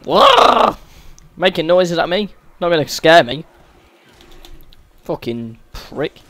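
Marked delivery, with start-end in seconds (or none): 0.0-0.8 high, loud startled cry; startled yell. Real, not acted. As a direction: yelp out as if jumped. Startled by an enemy in game.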